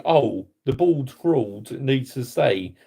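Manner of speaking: slow, lightly voiced mock of the pundits; mocking quote. Fan sneers at people defending the manager, slowly and mockingly. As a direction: drag it out like a daft opinion you scorn. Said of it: "how can you say".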